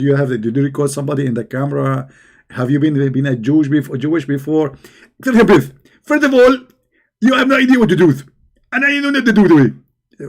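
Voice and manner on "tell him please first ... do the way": high, loud preachy mimic; Zakir Naik. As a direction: lecture like an excited preacher. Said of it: "Zakir Naik, who told you".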